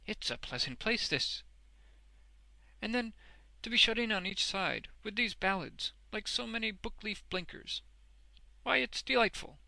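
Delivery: put-on high, delighted older character voice; great enjoyment. A narrator's reading. Boffin admires Wegg's stall, with great enjoyment. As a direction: relish every bit of the place. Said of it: "in a tone of great enjoyment".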